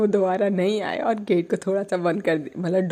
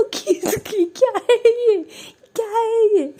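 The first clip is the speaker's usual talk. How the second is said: high, laughing disbelief; mock outraged what is this; indignant; 5 dB louder than usual. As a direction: scoff at it, half laughing.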